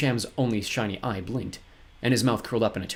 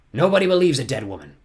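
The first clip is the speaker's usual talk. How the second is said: louder, dismissive villain; impatient; 4 semitones higher, 7 dB louder than usual. A narrator's reading. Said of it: "said impatiently".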